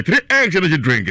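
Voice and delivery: high, loud brand call; hyped ad slogan. Acted. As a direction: punch the brand name. Slogan again.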